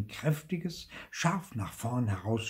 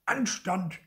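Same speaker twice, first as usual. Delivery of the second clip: higher-pitched stern command; 8 semitones higher than usual. A narrator's reading. Zorntigel demanding manners from the mouth, commanding. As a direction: demand it as a one-word order. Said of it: "gebot Zorntigel".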